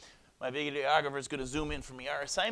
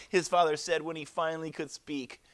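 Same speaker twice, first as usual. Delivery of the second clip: plain reading voice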